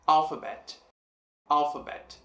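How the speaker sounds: "alphabet" with a strong first syllable "al"; stress on first syllable. Shown as a demonstration. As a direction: hit the "al" of "alphabet", then fall. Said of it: "Al sendo a sílaba mais forte". ("alphabet" com a primeira sílaba "al" forte)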